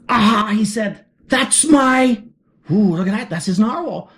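ordinary delivery, nothing marked

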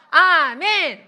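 shouted, drawn-out amen; rallying amen. Real, not acted. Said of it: "한 번 더".

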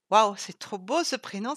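enthousiasme éclatant, plus fort; s'extasier; s'écrie. Acted. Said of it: "s'écria Sylvie". (Sylvie s'extasie sur le prénom, plus fort)

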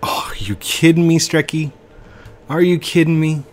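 high, loud exasperated complaint; exasperated disbelief. Real, not acted. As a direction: protest loudly at a teammate. Exasperated at Strecky in game.

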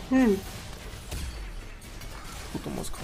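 usual speaking voice

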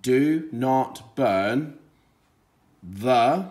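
"Do not burn" read very slowly, word by word. Shown as a demonstration. Teacher reading slowly for a child. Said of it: "You do it".

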